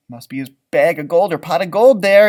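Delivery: usual speaking voice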